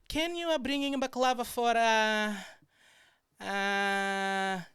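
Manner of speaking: high voice, long stalled fillers; imitated coworker. Coworker blanking on a name during a call, high mock voice. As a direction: hold each filler high while searching. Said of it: "So she's there".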